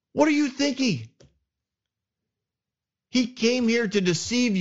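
high-pitched, outraged accusation; a general raging at his king for letting the enemy walk away; furious rebuke. Retold or imitated. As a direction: berate the king, voice climbing high in disbelief. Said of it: "He is so angry".

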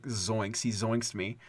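ordinary delivery

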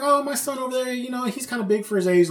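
high, chatty imitated mother; oblivious mom. Bully's mom talking to the girl's aunt, breezy.

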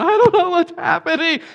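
a laughing man lost in the chaos; bewildered guy. Retold or imitated. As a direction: play the overwhelmed guy in the back. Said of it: "I'll be the guy in the back corner going".

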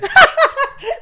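shrill burst of laughter; high squeaky laugh. Real, not acted. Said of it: "That noise is".

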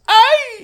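very high, loud imitated "Ay!" squeal; mock yelp. Imitating prankster as friends pump his chest, mock yelp. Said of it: "he goes".

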